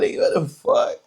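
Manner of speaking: a startled what the fuck; shocked outburst. Real, not acted. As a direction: react like you can't believe the haircut. Shocked reaction to her haircut.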